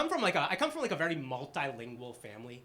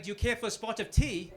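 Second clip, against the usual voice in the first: put-on British accent; 6 semitones higher than usual. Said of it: "they all have British accents".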